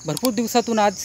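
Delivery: ordinary talk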